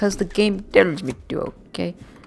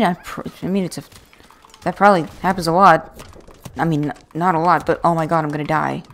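ordinary talk, nothing marked

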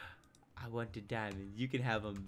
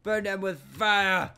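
high, loud, slow holler; gleeful over-the-top shout; mock war cry; 6 semitones higher, 10 dB louder than usual. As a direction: bellow it like a battle order, stretched out. Mock war cry while burning things.

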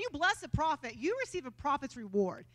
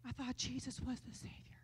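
unvoiced stage whisper; mock puzzled whisper; 11 dB quieter than usual. Pretending to puzzle over the Savior line to the congregation in a whisper. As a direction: whisper it like a confused student.

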